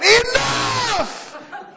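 high, loud comic shout. Retold or imitated. Preacher in the bath copying Wigglesworth, a loud shout. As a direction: belt it out. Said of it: "I shouted".

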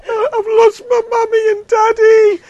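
very high, loud mock sobbing child voice; comic preacher voicing a weeping lost child; crying little kid. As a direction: whimper it like a lost toddler in tears. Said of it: "the little kid says".

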